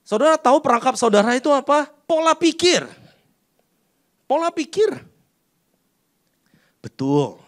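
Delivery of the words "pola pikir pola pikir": pola pikir, called out high and ringing; high emphatic answer. Acted. Preacher answers his own question.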